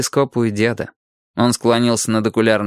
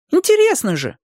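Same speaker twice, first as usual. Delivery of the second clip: put-on very high little-boy voice; pleading child. A narrator's reading. Boy begging Dinka for the secret, high and pleading.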